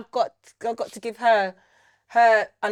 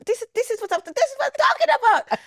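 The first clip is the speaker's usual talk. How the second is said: high, emphatic motherly approval; exaggerated, high-pitched "that's more like it"; imitating mum; 3 dB louder than usual. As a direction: crow approval as a hammed-up mum. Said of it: "she's like".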